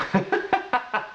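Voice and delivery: high chuckle; short, high laugh at oneself; quick high-pitched giggle. Real, not acted. Laughs at own tangent.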